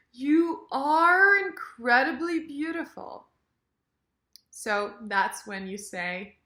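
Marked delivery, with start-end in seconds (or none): 0.1-3.3 slow, high, loud push on "are"; emphatic "are". Shown as a demonstration. Teacher models emphasis on verb, stressed "are". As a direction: stretch "are" before "incredibly beautiful". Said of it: "more emphasis on the verb".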